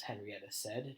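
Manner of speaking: usual speaking voice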